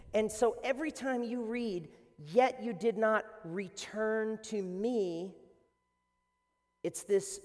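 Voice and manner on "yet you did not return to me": slow, weighty "Yet you did not return to me"; slow solemn refrain; slow emphasis. Shown as a demonstration. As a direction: drag it out so it lands.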